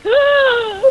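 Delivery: high, loud panicked cry; panicked yelp. Pretend. Imitates someone panicking.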